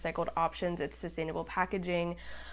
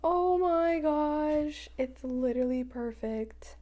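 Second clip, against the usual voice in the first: high, slow, awed gasp; breathless awe; 8 semitones higher, slower than usual. Real, not acted. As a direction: marvel at it, hushed and high. First gasp at the washi tape.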